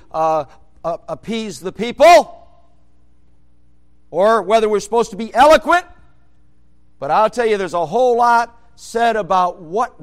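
loud, high preaching voice; full-force preaching. Acted. Preacher pounding home a point to congregation, loud. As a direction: preach it hard to the pews.

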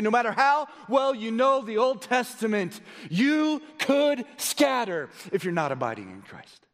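full-force pulpit exhortation; impassioned preaching. Real, not acted. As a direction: exhort the congregation with rising urgency.

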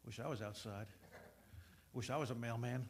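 soft, wistful musing; dreamy inner voice. Retold or imitated. Bored student daydreaming in class, softly wistful. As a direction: drift off quietly.

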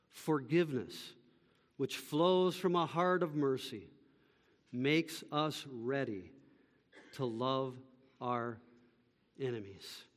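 very soft and low, broken by pauses; solemn repetition. Real, not acted. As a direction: dwindle phrase by phrase to a near hush. Repeats key sentence for the congregation.

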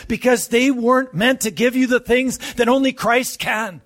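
ordinary talk

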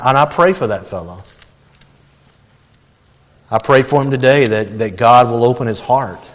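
ordinary talk, nothing marked

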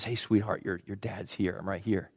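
put-on soft, low, tender reassurance to a baby; soothing father. Dad speaking to his crying newborn in hospital, soothing. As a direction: hush your voice and gently comfort.

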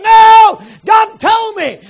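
high, loud firm cry; insistent shout. Retold or imitated. Husband overrides wife, shouting his faith. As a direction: insist with full conviction.

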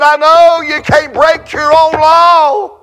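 high, wailing preacher's imitation; tearful plea. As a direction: plead as if weeping. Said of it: "She begins to weep".

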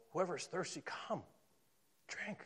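tender invitation to come and drink; gentle invitation. Acted. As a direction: invite them in softly.

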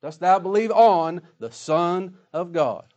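slow and loud, on held long and falling; stress on ON. Shown as a demonstration. Preacher quoting Jesus, slow with on weighted. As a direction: land hard on on, slowly. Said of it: "not in".

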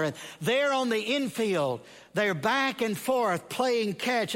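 ordinary delivery

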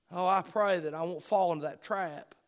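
usual speaking voice

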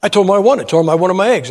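ordinary talk, nothing marked

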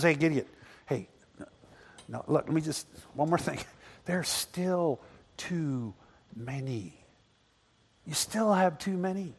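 quiet, low voice of God speaking; hushed voice of God. Retold or imitated. God quietly telling Gideon he still has too many.

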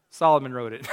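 amused laugh at his own punchline. Real, not acted. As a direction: chuckle through the payoff. Punchline about changing scholars.

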